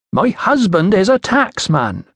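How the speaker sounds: put-on high, slow Iceni-accented explanation; foreign Iceni accent. A narrator's reading. Woman explains her husband's job to the official, Iceni accent. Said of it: "trouble following your accent".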